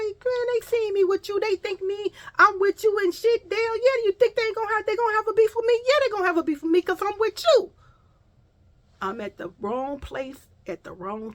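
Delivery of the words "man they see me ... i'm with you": much higher, louder, worked-up complaint; heated retold protest. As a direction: pile on the alarm, voice climbing as she argues. Arguing heatedly with the friend.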